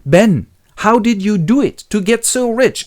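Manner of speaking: high, loud admiring question; acted awe. Willie asks his imaginary brother about riches, awestruck. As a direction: marvel at your brother, voice lifted. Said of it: "seen his brother Ben and goes".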